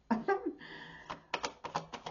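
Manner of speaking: airy high giggle; a light unvoiced laugh; breathy laugh. Real, not acted. Reading tarot to viewers, a breathy giggle.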